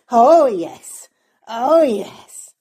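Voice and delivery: loud gleeful oh yes; excited. Real, not acted. Dog knows it's walk time, loud cheering.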